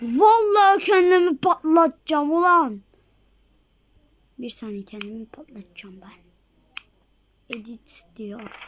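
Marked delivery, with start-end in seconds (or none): 4.4-8.6 fast whisper aside. Real, not acted. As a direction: whisper it quickly under your breath. Sudden aside under his breath.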